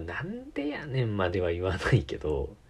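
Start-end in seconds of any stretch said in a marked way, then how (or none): 0.0-1.1 Kansai dialect. Retold or imitated. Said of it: "東京の人からしたら関西弁って".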